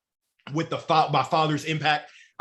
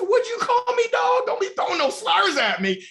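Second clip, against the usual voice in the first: much higher, put-on offended voice; mock offended; 4 dB louder than usual. To a runner who called him dog, mock offended high voice. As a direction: fake outrage at a supposed slur.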